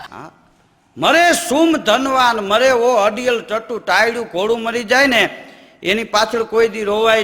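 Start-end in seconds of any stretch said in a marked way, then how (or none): 1.0-3.8 chanted doha, slow and ringing; poem recital. Acted. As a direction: declaim the couplet slowly like a folk poet. Repeats couplet lines.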